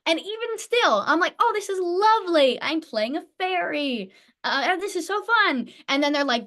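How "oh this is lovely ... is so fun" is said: high-pitched gushing delight, retold; mock-dreamy glee at a lovely part. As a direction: gush sweetly, lifting the pitch. Acting out her delight at role.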